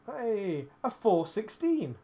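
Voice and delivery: high, loud, slow excited shout; excited call-out. Real, not acted. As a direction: call it out, thrilled at the find. Calling out a found rifle.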